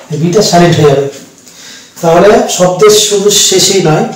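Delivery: ordinary talk, nothing marked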